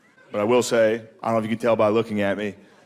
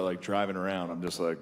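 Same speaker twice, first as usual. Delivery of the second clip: ordinary talk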